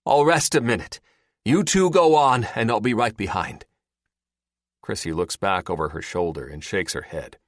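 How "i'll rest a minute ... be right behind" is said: raised, high calling voice. A narrator's reading. Terry sending the two teenagers on, calling out. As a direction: call it out to those ahead. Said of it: "Terry calls out".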